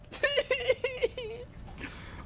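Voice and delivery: very high breathless wheeze; wheezing laugh. Real, not acted.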